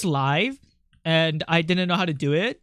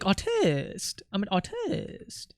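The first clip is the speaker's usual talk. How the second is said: slightly French accent; 7 dB quieter, much slower than usual. Shown as a demonstration. Streamer demonstrates a French way to say artist, soft French accent. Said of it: "I'm saying artist in, like, a weird accent".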